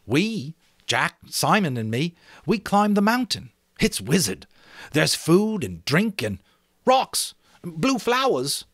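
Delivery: a schoolboy showing off his island; Ralph, excited about the island; boy Ralph, eager. Acted. Ralph voiced in story reading.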